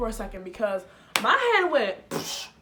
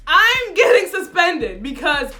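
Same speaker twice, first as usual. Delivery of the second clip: loud, higher protest; indignant rant; 6 semitones higher, 9 dB louder than usual. Real, not acted. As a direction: complain bitterly. Ranting about injustice.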